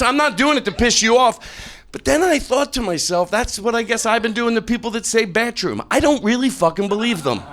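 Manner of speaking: normal talking voice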